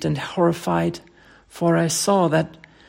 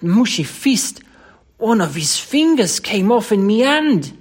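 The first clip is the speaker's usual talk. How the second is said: higher, louder horrified Cockney; horrified loathing; 5 semitones higher, 6 dB louder than usual. Acted. Thomas reveals the finger to his employer, appalled Cockney. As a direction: drag out the revolting details in Cockney. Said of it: "utter loathing and horror of Thomas's".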